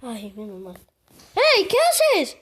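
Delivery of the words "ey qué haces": loud shout. Real, not acted. Shouting at the game.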